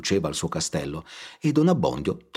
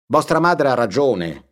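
don Abbondio's voice; 7 semitones higher, 6 dB louder than usual. Acted. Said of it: "disse don Abbondio".

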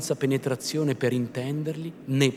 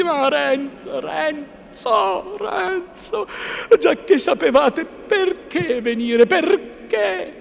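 high, drawn-out female plea; high-pitched despairing woman's voice; anguished pleading; 13 semitones higher, 7 dB louder, slower than usual. A narrator's reading. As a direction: beg him in distress. Lucia pleading with Renzo.

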